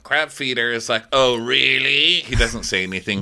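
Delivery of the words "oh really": slow, louder, rising "oh, really"; mock unimpressed. Crab feeder answering the captive's insults, drawn-out mock doubt. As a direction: stretch it out as a taunting question. Acts villain's reply to insults.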